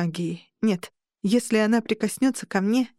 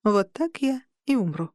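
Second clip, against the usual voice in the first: ordinary delivery, nothing marked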